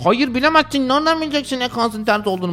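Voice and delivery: high, mocking know-it-all voice; whiny stubborn listener; inatçı dinleyici taklidi. (alaycı, tiz inatçı dinleyici sesi)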